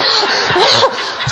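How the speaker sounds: high bursting laugh; real high laugh; amused laugh. Real, not acted. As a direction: laugh at the son's reason. Laughing at son's answer.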